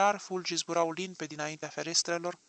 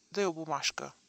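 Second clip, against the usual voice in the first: ordinary delivery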